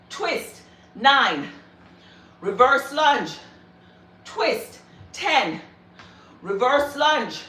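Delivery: energetic rep-counting cues; workout coaching. Acted. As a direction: call out each move like a trainer. Coaching live lunge reps.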